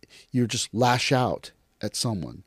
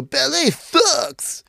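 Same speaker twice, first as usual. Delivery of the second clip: high, very slow mock yell; hammed-up internet troll, pitched way up; ignorant online hater; 10 semitones higher, much slower than usual. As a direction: drag out each word in a high jeering voice. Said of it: "then you go".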